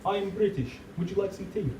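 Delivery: soft, frowning British accent; hushed caricature of British speech; mock British accent. Shown as a demonstration. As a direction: politely offer tea in put-on British. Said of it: "British people speak like this".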